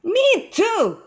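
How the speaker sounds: high-pitched chiming-in complaint; crying out a complaint. A narrator's reading. Another walker joining the grumbling, high complaining cry. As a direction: pipe up high, fed up. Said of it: "cried another voice".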